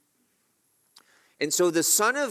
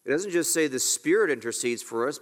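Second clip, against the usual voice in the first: ordinary talk, nothing marked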